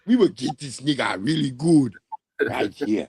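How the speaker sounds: high, excited put-on Haitian accent; mock Haitian accent. Host imitating a Haitian guy waiting to strike, mock Haitian accent. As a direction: imitate a Haitian man plotting eagerly. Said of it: "The Haitian be like".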